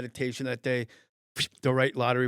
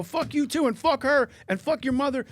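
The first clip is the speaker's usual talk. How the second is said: high, loud escalating insults; gone nuts; 12 semitones higher, 4 dB louder than usual. Retold or imitated. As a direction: re-enact losing it at a heckler. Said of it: "I'm just nuts".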